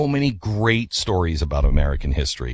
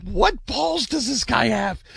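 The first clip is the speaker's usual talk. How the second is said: high, slow, stretched-out disbelief; astonished slow exclamation; amazed disbelief; 14 semitones higher, slower than usual. Retold or imitated. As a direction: marvel at the nerve of it, way up high. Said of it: "And I'm like".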